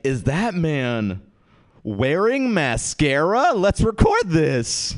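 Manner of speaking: slow, high mock astonishment; gawking onlookers. Onlookers spot crying man's mascara, mock amazed. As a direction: stretch it out, climbing into excited gawking.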